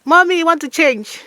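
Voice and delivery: put-on high, pleading whiny child voice; whiny daughter. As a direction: whine to mommy for help. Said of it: "You're calling your mother".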